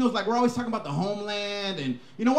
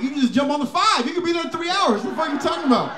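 louder, higher exasperated son; exasperated reply; 6 semitones higher, 3 dB louder than usual. Retold or imitated. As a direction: spell out the obvious to your dad. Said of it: "I'm like".

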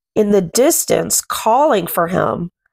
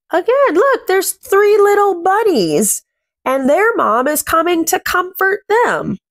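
delighted and much higher pitched; excited, much higher; 9 semitones higher than usual. Real, not acted. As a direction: lift way up as if spotting something sweet. Excited about bunnies picture.

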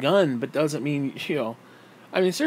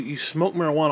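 ordinary delivery

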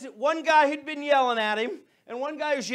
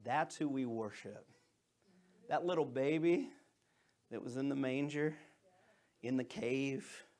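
much softer and lower, unhurried; tender; 10 semitones lower, 10 dB quieter than usual. Real, not acted. Pastor reflecting to the congregation, soft and tender. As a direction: speak it gently, in awe.

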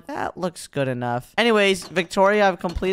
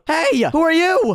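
calling out; 13 semitones higher, 5 dB louder, slower than usual. Real, not acted. Calling to stranger.

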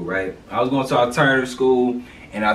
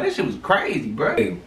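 normal talking voice

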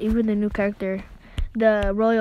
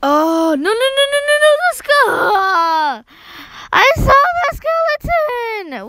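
high, loud, drawn-out no's; much higher wail of alarm; panicked shout; 12 semitones higher, 6 dB louder, slower than usual. Real, not acted. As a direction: scream at the screen in horror. Losing, screams at screen.

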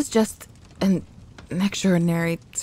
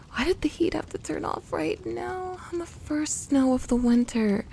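exasperated whine; 8 semitones higher, 5 dB quieter than usual. Acted. Complaining about the broken heater.

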